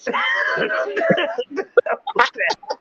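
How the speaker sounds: hammed-up shriek; high mock scream. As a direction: let out a wild high yell. Kid at door, Shatner scream.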